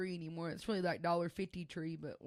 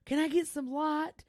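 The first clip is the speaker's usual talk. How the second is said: imitating a frantic husband, high and loud; slow, loud mock-panicked demand; psychotic, freaking out; 8 semitones higher, 6 dB louder than usual. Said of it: "down there being psychotic".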